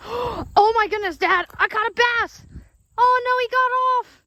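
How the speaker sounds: high, breathless excitement; gasp, then shout to Dad; excited. Real, not acted. Catching a bass, losing it.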